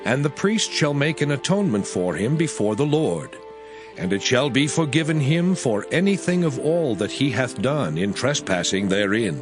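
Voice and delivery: ordinary delivery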